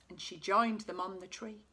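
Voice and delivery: usual speaking voice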